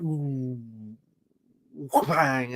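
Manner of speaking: soft drawn-out ooh, then bang; soft wind-up noise, pause, impact word; mimed slow-motion punch. Retold or imitated. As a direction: imitate a slow-mo punch landing. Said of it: "another slow motion thing".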